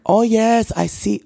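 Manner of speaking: high, badly faked English accent; failed English accent. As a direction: attempt an English accent and miss. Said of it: "Okay, that ain't English".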